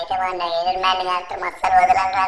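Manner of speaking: urgent, anxious muttered-to-self rush; frantic Franklin. Acted. Franklin rushes Chinchan to hospital, urgent and upset. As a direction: reassure the injured friend while panicking.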